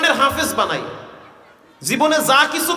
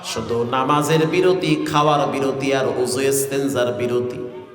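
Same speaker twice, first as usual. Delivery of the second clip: melodic waz preaching; 8 semitones lower than usual. Acted. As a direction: intone the life story on a low note. Tuneful sermon storytelling. (নিচু সুরে ওয়াজ)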